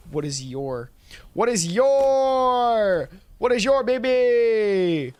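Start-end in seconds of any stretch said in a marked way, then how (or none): 1.3-5.1 loud, high, long-held final words; mock over-the-top cry; hammed-up holler. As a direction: belt it out slowly, stretching the ends. Breaks into performed drawn-out bit.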